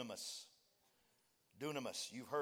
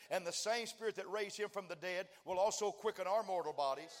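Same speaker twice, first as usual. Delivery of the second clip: ever louder, higher sermon climax; preaching at full force; 7 semitones higher, 8 dB louder than usual. Acted. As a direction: build it to a roaring peak. Preacher's climax keeps building louder.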